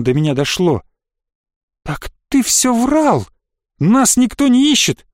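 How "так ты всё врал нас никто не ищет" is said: high, drawn-out outraged cry; angry accusation. A narrator's reading. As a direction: accuse the liar in dismay. (возмущённое обвинение)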